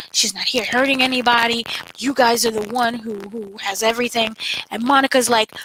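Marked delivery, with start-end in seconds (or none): none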